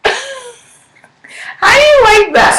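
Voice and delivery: high, slow, teasing drawl. Real, not acted. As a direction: tease her, stretch it out. Teasing reaction to confession.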